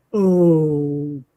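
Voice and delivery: long, drawn-out reluctant okay; stalling, uneasy agreement; hesitant. Retold or imitated. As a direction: stretch it out slowly, unsure. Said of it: "and I'm kind of hesitant".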